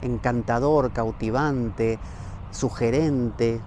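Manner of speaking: slow, drawn-out adjectives; slow, savoring. Shown as a demonstration. Listing rich words for the mountain, slow and savoring. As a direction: linger on each word. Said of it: "miles de epítetos".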